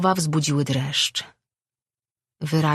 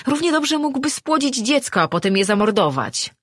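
much higher, angry accusation; accusing outburst; 10 semitones higher, 4 dB louder than usual. Acted. Man likening his fate to a murdered child, outraged. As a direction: throw it out in high-pitched outrage. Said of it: "spojrzał na nią oskarżycielsko".

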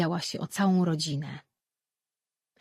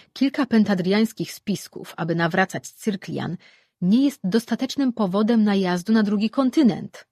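raised, assured god's voice; a god dismissing the plotters, raised and assured; firm god; 4 semitones higher, 7 dB louder than usual. Acted. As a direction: lay down the law like a deity. God Lore explains firmly.

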